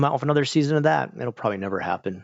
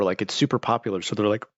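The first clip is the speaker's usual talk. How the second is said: ordinary talk, nothing marked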